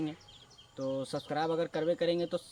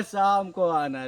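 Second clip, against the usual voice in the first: slow, louder, drawn-out "शाम को आना"; mock sweet brush-off; 6 dB louder, much slower than usual. Shopkeeper sweetly putting off the cousin, slowly drawn out. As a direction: drag "शाम को आना" out like a sugary dodge. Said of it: "वो बोलता है".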